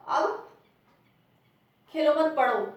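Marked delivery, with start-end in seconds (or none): 1.9-2.6 खेलो मत पढ़ो run together without a break; no pause. Shown as a demonstration. Teacher showing the sentence is unclear without a mark, no pause. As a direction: read खेलो मत पढ़ो loudly as one unbroken phrase. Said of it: "यहाँ पे पता ही नहीं चल रहा है".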